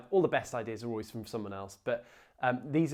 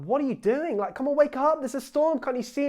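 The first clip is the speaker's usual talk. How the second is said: much higher, louder, exasperated shouting; frantic plea; 12 semitones higher, 9 dB louder than usual. Retold or imitated. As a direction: shake a sleeping Jesus awake in mock alarm. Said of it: "they're like".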